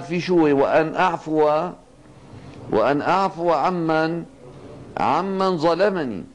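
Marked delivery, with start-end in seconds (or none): none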